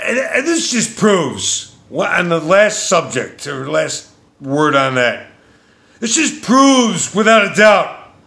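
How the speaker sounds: real fury, shouted slowly; angry rant. Real, not acted. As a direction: let the rage boil over in a high yell. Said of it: "Yes, I'm very angry".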